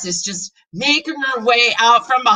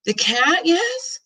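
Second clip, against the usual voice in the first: puzzled rising question, high at the end; confused question; 8 semitones higher, much slower than usual. A narrator's reading. Child puzzled by her parents, confused question. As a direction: ask it baffled, voice lifting. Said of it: "I ask, confused".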